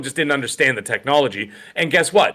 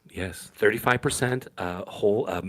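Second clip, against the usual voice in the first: soft low ASMR murmur; 7 semitones lower, 6 dB quieter than usual. Pretend. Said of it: "in your A S M R voice".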